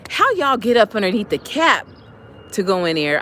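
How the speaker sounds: high, loud indignant fussing; mock scolding. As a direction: tell off the ants in playful outrage. Scolding the ants in a story.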